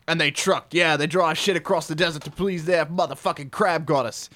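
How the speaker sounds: hammed-up, sweary Aussie rules reading; put-on broad Australian accent, punching the swear words; bad Aussie accent. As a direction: read it out like a crude Australian trucker. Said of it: "do it in Aussie accent".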